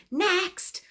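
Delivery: high-pitched imitation of kids calling out; mock shout. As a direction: call it out high, like kids in a back seat.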